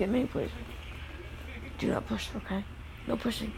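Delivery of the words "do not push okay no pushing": quiet stern warning. Real, not acted. Scolding DJ for pushing.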